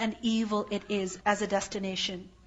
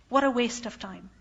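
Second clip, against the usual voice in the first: ordinary talk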